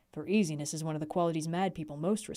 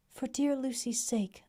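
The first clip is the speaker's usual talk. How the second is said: tender echo, higher; 6 semitones higher, slower than usual. Acted. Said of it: "as we clasped hands".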